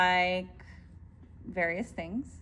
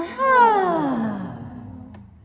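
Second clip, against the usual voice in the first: big audible sigh, falling; loud exhale sigh; 8 semitones higher, 6 dB louder than usual. Shown as a demonstration. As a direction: let the breath out on a long sigh. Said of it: "exhale on a sigh".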